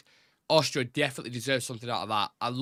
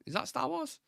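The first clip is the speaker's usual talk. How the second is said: high squeaky question; 12 semitones higher, 5 dB quieter than usual. Pretend. Voice stays raised after impression.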